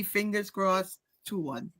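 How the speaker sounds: ordinary delivery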